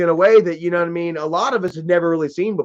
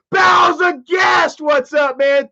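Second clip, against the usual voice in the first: excited greeting; 8 semitones higher, slower than usual. Real, not acted. Greeting a chat viewer.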